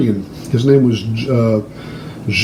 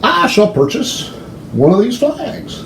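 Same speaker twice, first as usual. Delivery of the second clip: lightly hammed-up posh purchase, pitched high; a hint of stiff formality, then delight; mock formal; 7 semitones higher, 4 dB quieter than usual. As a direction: play the overly polite tourist. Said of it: "And I said".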